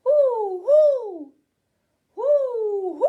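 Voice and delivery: high, loud hooting owl calls. Shown as a demonstration. Teacher hooting like an owl for children. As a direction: hoot like an owl for the kids. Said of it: "they make the sound".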